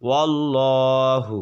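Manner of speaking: ওয়াল্লাহু said slowly with a thick, full লাম; heavy lam, slow. Shown as a demonstration. Teacher demonstrating the full lam. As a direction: stretch ওয়াল্লাহু and darken the লাম. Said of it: "বলতে হবে".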